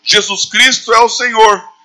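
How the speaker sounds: high, slow, ringing proclamation; bold declaration. Acted. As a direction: declare it firmly to the whole room. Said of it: "aquilo que nós falamos facilmente".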